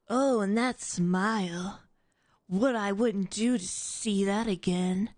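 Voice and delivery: slow, dreamy audiobook narrator; cheesy narrator. Retold or imitated. Mocking the audiobook narrator's cheesy line, slow. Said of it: "It's literally how it's read".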